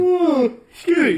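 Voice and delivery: high startled apology; surprised. Real, not acted. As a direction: yelp it, then excuse yourself. Sudden hiccup or burp.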